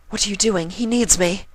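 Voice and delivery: very high, urgent plea; high frantic Rarity voice; desperate pony. A narrator's reading. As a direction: push past her in alarm. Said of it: "trying to get past her".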